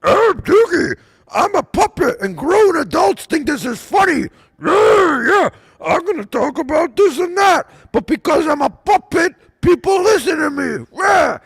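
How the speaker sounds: mocking a puppet in a high, shouting squeak. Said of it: "then you got this thing".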